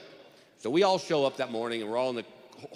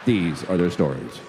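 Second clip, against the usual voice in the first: Law and Order narrator; 7 semitones lower, 5 dB louder than usual. Retold or imitated. Juror showing off to the lawyer with a deep, grave TV intro voice. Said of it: "I can sing you the song".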